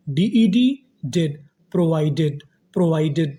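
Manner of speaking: ordinary delivery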